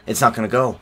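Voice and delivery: ordinary talk, nothing marked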